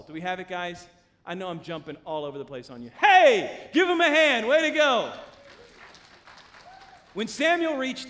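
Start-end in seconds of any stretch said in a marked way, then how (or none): 3.0-5.1 loud, high cheering call; whooping up a round of applause; rousing cheer. Real, not acted. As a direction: holler for the crowd to applaud. Calls for applause from congregation.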